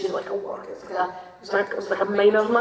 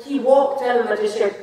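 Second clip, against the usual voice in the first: ordinary delivery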